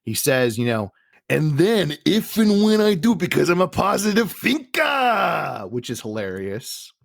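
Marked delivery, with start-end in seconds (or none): 1.3-5.7 high, loud wrestler promo growing to a shout; Macho Man. Retold or imitated. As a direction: stretch the last word into a hammed-up yell. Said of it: "Mach, you know, goes back on the mic".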